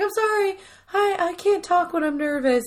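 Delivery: high-pitched imitation of a flustered woman; nervous chatty lady. Voicing flirty, nervous lady in retelling.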